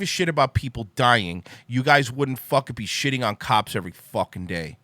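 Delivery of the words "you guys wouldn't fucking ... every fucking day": ordinary talk, nothing marked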